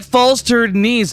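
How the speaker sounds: normal talking voice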